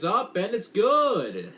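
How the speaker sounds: big play-by-play shout of triumph; excited call. Real, not acted. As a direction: sell the made kick to the crowd. Field goal call on broadcast.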